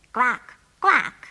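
loud, put-on quacking; duck quacks. A narrator's reading. Duck voice answers the phone.

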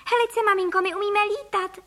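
put-on high-pitched little child voice; high, birdlike child's chatter; chirping. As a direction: pipe it up proudly to mother. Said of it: "cvrlikal Filip".

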